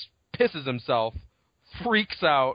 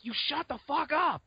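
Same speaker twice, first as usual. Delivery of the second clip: mock angry hushing; 13 semitones higher, 3 dB quieter than usual. The others telling screaming Lex off, mock exasperation. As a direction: imitate an exasperated hush in a high voice.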